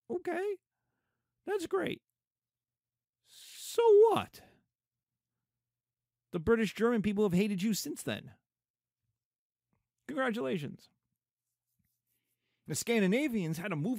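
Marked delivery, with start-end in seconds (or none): 0.1-4.4 high mocking so what, much higher, louder at the end; sarcastic dismissal. Teacher mocking an old-stock family's boast, sarcastic. 10.1-10.9 sarcastic. Pretend. Sarcasm toward old-stock family.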